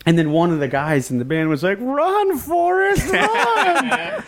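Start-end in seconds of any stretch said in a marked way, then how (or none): 1.8-4.2 very high, drawn-up movie-quote holler; mocking call-out. Bandmate heckling the skinhead chasing a van, mocking call. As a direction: imitate a heckler calling out in a high voice.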